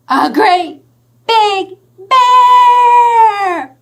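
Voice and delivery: high, loud, sung-out announcement; big reveal. Acted. Storyteller unveiling the bear for children, loud and high. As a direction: trumpet the bear's arrival.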